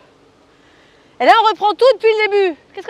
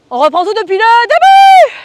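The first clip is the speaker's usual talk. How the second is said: loud, slow, higher call to the class; rallying call; 5 semitones higher, 9 dB louder, slower than usual. Acted. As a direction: belt it out to restart everyone. Coach rallying the group.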